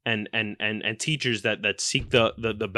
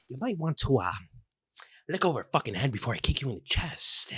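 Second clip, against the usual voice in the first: quiet threat; 7 dB quieter, faster than usual. Acted. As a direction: threaten him under your breath.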